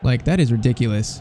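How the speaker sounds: ordinary talk, nothing marked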